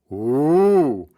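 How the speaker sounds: loud, swooping crowd "ooh"; long rising and falling ooh of amazement; children's "ooh". Acted. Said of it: "said the children".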